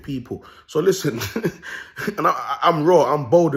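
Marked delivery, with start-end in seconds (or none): 1.2-2.1 high, breath-heavy little laugh; real quick snicker; short chuckle. Real, not acted. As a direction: break into a brief cackle. Laughs before boasting.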